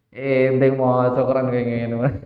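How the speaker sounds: a lightly voiced jinn, low and scolding; mocking jinn. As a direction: play the jinn jeering at the exorcist. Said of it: "diseneni karo jinnya". (jin mengejek dengan suara rendah)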